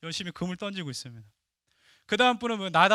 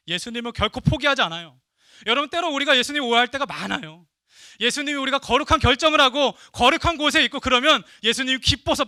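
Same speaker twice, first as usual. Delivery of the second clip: loud, high, driving sermon climax; preaching at full force; 6 semitones higher, 9 dB louder than usual. Acted. Pastor proclaiming to the congregation, loud and rising. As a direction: build and pound the message home.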